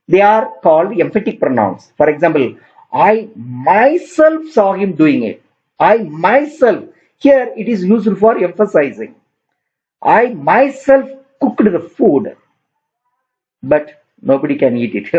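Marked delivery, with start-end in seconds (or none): 2.9-6.8 slow, drawn-out emphasis on "myself"; teacher stressing "myself" slowly and deliberately. Shown as a demonstration. Demonstrates an emphatic pronoun example. 10.0-12.4 "myself" stretched out slowly for emphasis; slow down and lean on "myself"; deliberate teacher's emphasis on "myself"; stress on myself. Shown as a demonstration. Second emphatic pronoun example.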